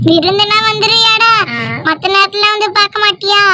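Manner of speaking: put-on granny chiding the boy playfully; mock scolding grandma.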